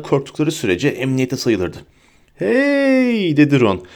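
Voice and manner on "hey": high, drawn-out giddy call; long high sing-out; giggly drunken Ron. A narrator's reading. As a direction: call out like a tipsy friend. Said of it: "kıkırdayarak".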